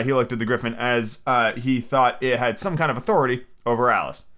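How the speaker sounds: ordinary delivery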